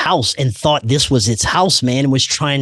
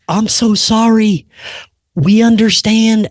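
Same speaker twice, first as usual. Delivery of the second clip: higher, slow and louder frightened apology; scared apology; 9 semitones higher, 4 dB louder than usual. Retold or imitated. Frightened spirits apologizing to him, high and slow. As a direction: spirits backing down in fear. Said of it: "they got so sc".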